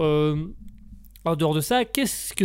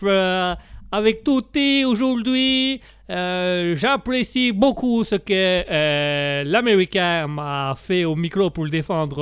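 slow, hammed-up Italian accent with drawn-out vowels; sing-song mock Italian accent, halting for words; 3 dB louder, much slower than usual. Italian guest greets the radio host, effusive Italian accent. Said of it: "nous allons vous présenter l'Italien".

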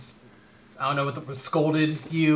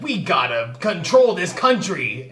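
slow, higher-pitched mock zealot; weirdo never-Trumper; 4 semitones higher, 4 dB louder, slower than usual. Mimicking her husband's never-Trump politics, weirdo zealot voice. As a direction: draw it out like a political crank. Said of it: "one of those weirdo never Trump people who's like".

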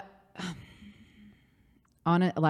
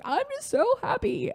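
high squeaky weepy voice, much higher; imitating herself crying happy tears, pitched way up; mock sobbing. Retelling crying in the Cotswolds, high tearful voice. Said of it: "like, sobbing".

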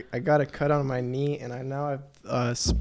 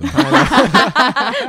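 much higher and louder, slow and laughing; pineapple catchphrase; 13 dB louder, much slower than usual. Shown as a demonstration. Teased about pineapple pizza, he squeals "pineapple" laughing. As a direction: belt out "pineapple" like a running joke. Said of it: "We're never letting that one go".

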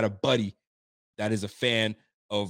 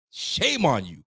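mock scolding; 3 semitones higher, slower than usual. Real, not acted. Scolding the analyst again.

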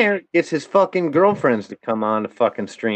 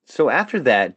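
ordinary talk

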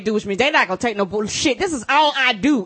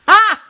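loud, high laughing "Ah!"; laughing shout; 11 semitones higher, 9 dB louder than usual. Real, not acted. Co-host bursts out laughing at the joke, loud shout.

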